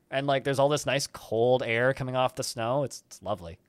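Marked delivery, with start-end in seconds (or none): none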